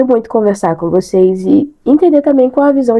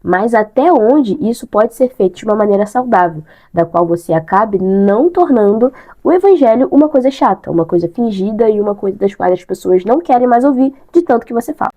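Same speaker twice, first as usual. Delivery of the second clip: ordinary delivery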